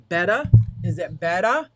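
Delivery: testing the mic, loud and close; asking loudly if the mic sounds better; loud mic check. Real, not acted. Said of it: "Maybe if I point it at me".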